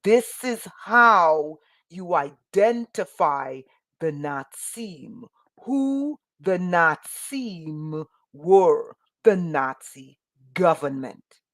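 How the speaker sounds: usual speaking voice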